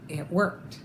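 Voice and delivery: plain reading voice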